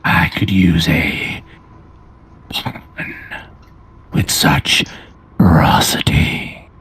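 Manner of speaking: low, rasping dragonborn menace; deep gravelly voice. Acted. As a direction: growl it slowly from deep in the throat. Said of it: "A deep, gravelly voice".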